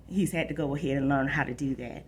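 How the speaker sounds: plain reading voice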